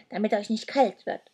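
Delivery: put-on lightly growled old creature; a hint of a growl. A narrator's reading. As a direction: grumble it gruffly. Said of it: "knurrte sie".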